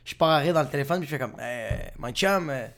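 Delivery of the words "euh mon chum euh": low, soft, halting reply; hesitant correction. Retold or imitated. Actor correcting a producer on the phone, soft and hesitant. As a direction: correct him gently, with pauses.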